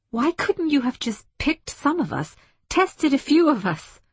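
high-pitched, insistent questioning; demanding. A narrator's reading. Woman confronts the ship's voice, demanding and high. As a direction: demand an answer, pitch pushed well up. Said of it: "she demanded".

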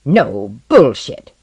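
slow, loud, disdainful dismissal; curt contempt, drawn out slowly; unpleasant voice. A narrator's reading. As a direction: stretch it out loudly and unpleasantly. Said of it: "said Osden's unpleasant voice".

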